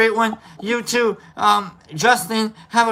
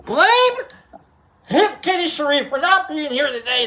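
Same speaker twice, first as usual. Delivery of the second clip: playful shout; 7 semitones higher than usual. Real, not acted. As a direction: shout the blame jokingly. Playfully blaming an absent viewer.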